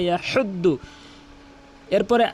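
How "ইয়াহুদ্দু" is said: "ইয়াহুদ্দু" with a mid-throat h; correct ha sound. Shown as a demonstration. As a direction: squeeze the h in "ইয়াহুদ্দু". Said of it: "হলকের মাঝখান থেকে". (হলকের মাঝখান থেকে হা)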